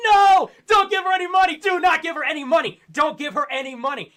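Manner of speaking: high shouted pleading, fading down; frantic shout at a streamer's viewers; mock screaming. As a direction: yell it like spamming the chat in panic. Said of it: "I'm in chat screaming".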